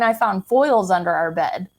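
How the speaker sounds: ordinary delivery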